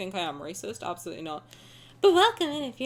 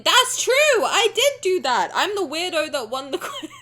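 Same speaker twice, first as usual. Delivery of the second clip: loud, high burst of agreement; excited confirmation; 6 semitones higher, 5 dB louder than usual. Real, not acted. Streamer confirming a quiz win to chat, excited. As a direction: proudly exclaim it, stretching the words. Delighted reply to chat comment.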